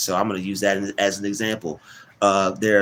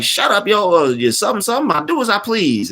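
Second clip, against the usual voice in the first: high, loud imitated old-lady retort; cranky old woman; 12 semitones higher, 5 dB louder than usual. Old woman defending her wax apple, mock cranky. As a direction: play a stubborn old lady, hammed up.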